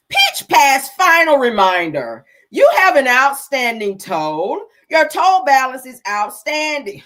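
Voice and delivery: loud, slow, over-formal read of the scam text; slow loud dramatic reading. Acted. Vlogger reading the scam text to viewers in a slow loud mock-official voice.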